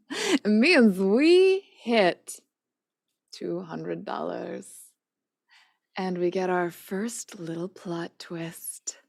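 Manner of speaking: slow, hushed teasing reveal; dramatic hush. Real, not acted. The GM teases the stream chat about the plot twist, slow and whispered. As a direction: drop to a slow whisper to build suspense.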